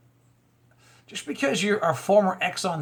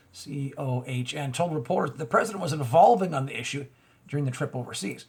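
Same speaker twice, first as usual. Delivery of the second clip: ordinary delivery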